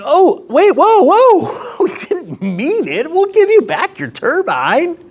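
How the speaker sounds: high, squealing mock panic. Germany begging Russia to calm down, hammed-up panic. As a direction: backpedal in a hammed-up fright.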